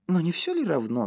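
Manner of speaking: high, carefree wifely voice; breezy high-pitched question; cheerful. A narrator's reading. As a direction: brush it off merrily. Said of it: "сказала Шейла весело".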